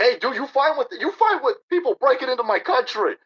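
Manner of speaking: taunting Louis C K while cutting the line; mock taunt. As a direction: needle him as you cut in line. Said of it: "You're like".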